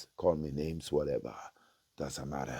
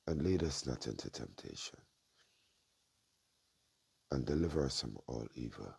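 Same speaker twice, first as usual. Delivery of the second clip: soft, low murmured prayer; prayer recitation; 4 semitones lower, 6 dB quieter than usual. Real, not acted. Alone before God, murmuring the Lord's Prayer in prayer. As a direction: pray quietly and reverently, line by line.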